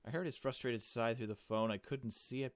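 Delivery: ordinary talk, nothing marked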